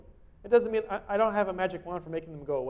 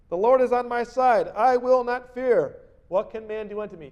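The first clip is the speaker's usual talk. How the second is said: scripture reading; 6 semitones higher, 9 dB louder than usual. Acted. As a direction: declare it with defiant confidence. Preacher reads psalm aloud.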